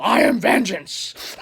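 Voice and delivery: slow, drawn-out dramatic shout; imagined yell. Pretend. Driver imagining yelling at drunks out the window, dramatic shout. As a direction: declare it like a hammed-up movie hero. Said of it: "yelled at them".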